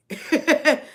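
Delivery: loud amused laugh; real loud laugh. Real, not acted. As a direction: burst out laughing, delighted. Laughs at spirit's joke.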